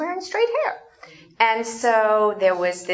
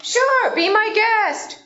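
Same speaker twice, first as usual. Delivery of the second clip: bright, high welcoming "Sure, be my guest"; friendly permission; 13 semitones higher, 4 dB louder, slower than usual. Shown as a demonstration. Teaching a class, a warm welcoming "be my guest". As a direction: grant permission gladly. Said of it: "What do we usually say?".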